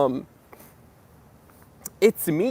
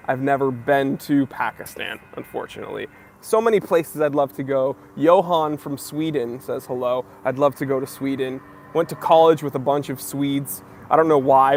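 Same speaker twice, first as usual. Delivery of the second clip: ordinary delivery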